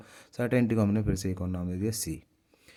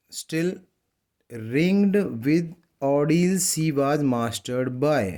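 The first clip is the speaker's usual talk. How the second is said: drawn-out reading of a poem line; slow poem recitation; 4 semitones higher, 4 dB louder, much slower than usual. Shown as a demonstration. Teacher reading a poem line to students, slow recitation. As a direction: read each word very slowly for the class.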